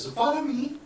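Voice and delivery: lightly voiced young woman inviting the party; follow me. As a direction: voice the guide girl high and light. GM voices NPC guide.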